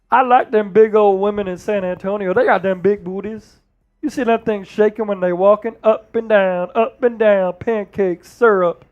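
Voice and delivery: riff as the folksy ex-player analyst; punchy sportscaster caricature; Charles Barkley. Acted. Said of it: "That's the Charles Barkley".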